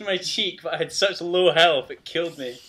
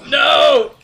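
loud, high "no"; real yell of dismay; dismayed shout; 10 semitones higher, 11 dB louder than usual. Real, not acted. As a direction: cry out in protest. Losing in the game.

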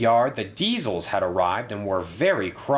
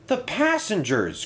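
high-pitched, mild cry of alarm; 11 semitones higher than usual. A narrator's reading. Percy fears for tumbled bus's passengers, crying out. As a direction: exclaim in concern, pitched up. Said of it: "cried Percy".

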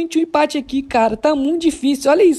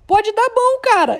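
normal talking voice

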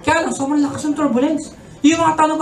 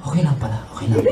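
much lower, mock-relieved murmur; relieved passengers; 11 semitones lower, faster than usual. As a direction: sigh as if all is fine. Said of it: "So yung mga tao".

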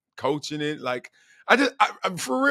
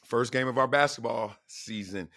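ordinary talk, nothing marked

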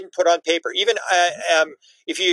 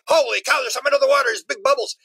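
higher, astonished pilot; mock pilot amazement; 6 semitones higher than usual. Fighter pilot spotting giant bubbles, higher amazed voice. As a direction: gawk like a stunned pilot.

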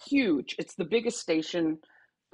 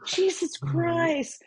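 slow, higher, drawn-out exasperation; exasperated; 11 semitones higher, much slower than usual. Retold or imitated. Her reaction to the crying kid, slowly exasperated. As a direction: sigh it out, fed up. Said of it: "And I was like".

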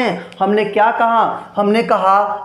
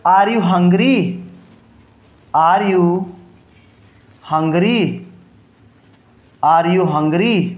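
slow dictation; 2 semitones lower, much slower than usual. Shown as a demonstration. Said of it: "फिर second हमने क्या लिखा भाई".

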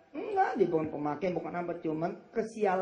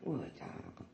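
whispered admiration; 5 semitones lower, 13 dB quieter, slower than usual. Real, not acted.

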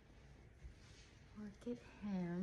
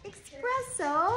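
excited coo; 8 semitones higher, 9 dB louder, slower than usual. Real, not acted. Greeting a new puppy.

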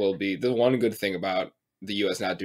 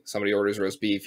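usual speaking voice

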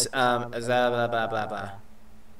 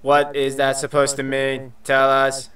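level monotone robot; 3 semitones lower, 3 dB quieter, slower than usual. Acted. Robot asking to be shown the forecast, monotone robot voice. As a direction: read it like a robot, pitch held even. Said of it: "try and do that robot voice".

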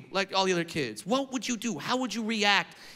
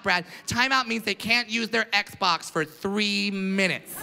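younger parent. Retold or imitated. Younger parents objecting to old-school discipline, mock fretful. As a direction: protest like an overprotective modern parent. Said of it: "Some of the younger parents are like".

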